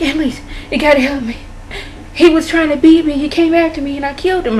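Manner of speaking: mock-helpless high voice; imitated distressed caller. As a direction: act out a desperate confession on the phone. Retells client's desperate call for help.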